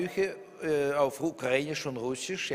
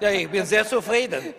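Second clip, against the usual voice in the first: higher and louder, laughing it out; cheerful laugh on the words; real laughter over his own joke; 6 semitones higher, 6 dB louder than usual. Real, not acted. Guest jokes about book ban.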